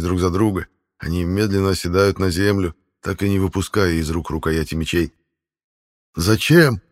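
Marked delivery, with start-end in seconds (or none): 6.1-6.8 a cry full of pain and despair; louder, pained outburst of grief; anguished cry. Acted. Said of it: "беззвучный крик, полный боли и отчаяния".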